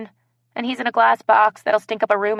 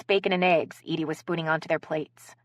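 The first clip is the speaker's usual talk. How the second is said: plain reading voice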